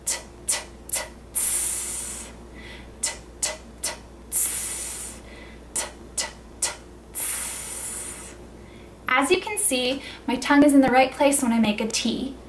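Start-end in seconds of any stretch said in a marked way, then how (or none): 0.1-8.4 T, T, then ts drawn out into a hiss; last T slides into a long S; lazy T. Shown as a demonstration. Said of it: "draw the last one out like this".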